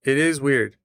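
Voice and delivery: normal talking voice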